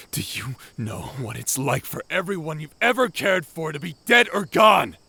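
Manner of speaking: anguished outburst. Acted. Pilot to Heartburn, confessing revenge killings in an anguished shout. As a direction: spit out the guilt until it breaks into a cry. Guilt-stricken confession, voice rising.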